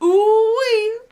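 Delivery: high, loud gleeful whoop; excited whoop. Acted. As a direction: whoop it out like a delighted old cowboy. Repeats the whoop.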